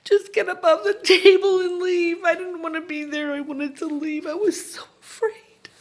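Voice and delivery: high plea breaking into real tears; crying while reliving the panic, voice high; frightened, tearful begging. Real, not acted. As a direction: beg to be let go, overcome with fear. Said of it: "I'm begging the doctor".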